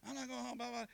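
ordinary talk

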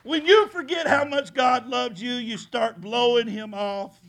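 loud, high restatement; preaching. Acted. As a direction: press it home.

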